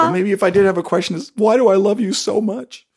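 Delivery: light mock celebrity; mock celebrity reply. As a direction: play the star answering sweetly. Playing celebrity answering fan.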